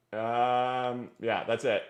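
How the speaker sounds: usual speaking voice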